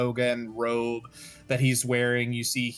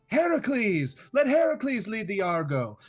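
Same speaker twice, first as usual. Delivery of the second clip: calling out from the crowd; 10 semitones higher than usual. Acted. Stranger calls out in crowd.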